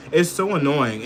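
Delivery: ordinary talk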